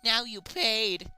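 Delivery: lightly voiced vengeful girl; hammed-up villain. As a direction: ham up the angry killer. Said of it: "I laughed pa-, with anger".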